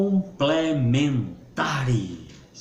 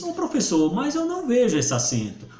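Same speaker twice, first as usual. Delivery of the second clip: higher, quicker student voice; objecting student; 8 semitones higher, faster than usual. Acted. Student challenging the teacher about the accent, acted higher. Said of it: "Aí você me diz".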